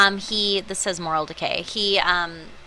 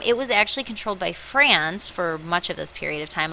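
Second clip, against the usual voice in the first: usual speaking voice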